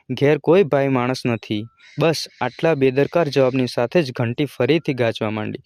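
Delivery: usual speaking voice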